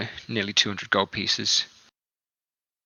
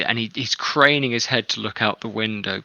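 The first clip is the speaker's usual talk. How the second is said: ordinary talk, nothing marked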